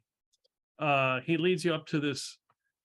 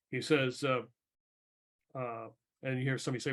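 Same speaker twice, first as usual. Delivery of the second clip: normal talking voice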